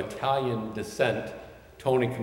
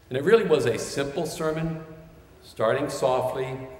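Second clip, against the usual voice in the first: usual speaking voice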